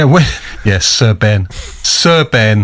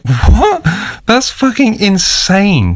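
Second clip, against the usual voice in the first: high, incredulous outburst; higher and slowed on insane; disbelief; 9 semitones higher, slower than usual. Real, not acted. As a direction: sound floored by the distance. Disbelief at a ten-hour drive.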